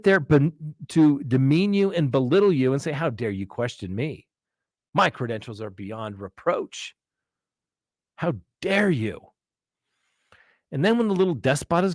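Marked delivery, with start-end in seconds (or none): 2.9-9.2 mock arrogance. Mocking a belittling official.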